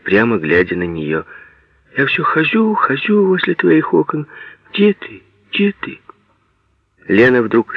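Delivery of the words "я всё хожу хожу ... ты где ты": put-on high, plaintive lovelorn boy; sad pleading. A narrator's reading. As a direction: plead mournfully in a raised pitch. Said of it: "печально сказал он".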